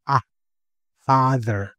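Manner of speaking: "Ah, father" with an open ah. Shown as a demonstration. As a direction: stretch the ah sound in "father". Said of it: "the A in father". (binibigkas na "ah" gaya sa "father")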